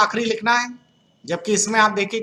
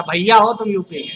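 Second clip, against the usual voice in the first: UP dialect remark. Retold or imitated. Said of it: "हमारी बोली से तुरंत पहचान जाता".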